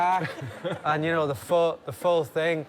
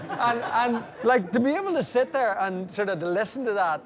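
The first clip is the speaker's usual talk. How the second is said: laughing; 9 semitones higher than usual. Real, not acted. Laughing after the impression.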